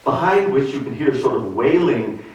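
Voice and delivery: ordinary talk, nothing marked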